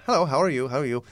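hammed-up comic Italian accent; stupid Italian accent. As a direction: introduce yourself as a silly Italian clown. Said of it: "in this stupid Italian accent".

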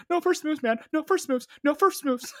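very high, loud squeaky repeats; hammed-up panic; panicked lunatic. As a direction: squeal it like a frantic broken record. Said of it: "I was, uh, I was a lunatic".

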